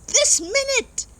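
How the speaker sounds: tiny mole defying the trucks; Mole yelling. Acted. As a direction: plant your feet and yell at the diggers. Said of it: "yelled Mole".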